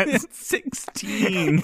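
very slow, high, drawn-out wonder; mock awe. Marveling at the sixteen-pizza joke, slow mock awe. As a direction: savor each syllable in hammed-up amazement.

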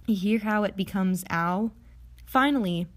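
usual speaking voice

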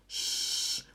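sustained soft shush; long hushing sh. Shown as a demonstration. Teacher demonstrating the SCH sound, long shush. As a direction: let out a long "shhh". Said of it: "o S C H você faz som de".

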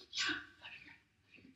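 soft, breathy gratitude; whispered thanks. Retold or imitated. Receiving groceries from a friend, whispered thanks. Grateful reply to neighbor.

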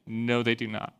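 low, soft meek reply; imitated chastened student, voice dropped; sheepish admission. Student conceding to the officer, sheepish.

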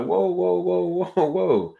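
high, rapid run of whoas; retold protest. Retelling trying to halt the man.

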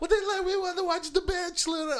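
put-on high whining girl; whiny girl voice. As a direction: whine it like a girl. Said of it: "she's like".

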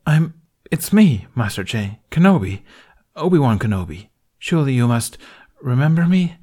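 hurt. A narrator's reading. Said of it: "a veiled expression of hurt".

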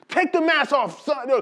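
high-pitched mock sergeant command; ordering sergeant. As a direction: give the order up high, like an instructor. Voices sergeant ordering mask off.

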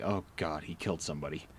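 low, soft inner dread; muttered dread. Retold or imitated. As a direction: mutter it under your breath. DM's inner panicked thought.

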